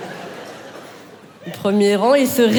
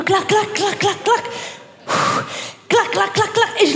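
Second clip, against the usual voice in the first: rhythmic clac sounds; 6 semitones higher, slower than usual. Acted.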